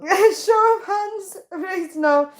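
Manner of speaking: high, giggling through the words; real giggling protest; laughing. Real, not acted. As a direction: laugh and plead at once. Laughing while reading chat.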